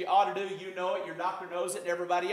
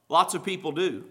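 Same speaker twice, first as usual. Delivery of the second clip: usual speaking voice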